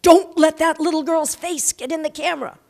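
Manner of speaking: loud, high booming call from above; big voice crying out. Retold or imitated. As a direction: director shouts down from the crane at the crowd, booming. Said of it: "I hear this big voice cry out".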